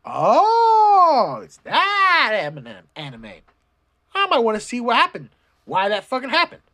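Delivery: high, loud delighted recognition; realizing excitedly. Retold or imitated. Vlogger recognizing the anime, high excited exclamation. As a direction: sound like you just spotted the show. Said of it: "I'm like".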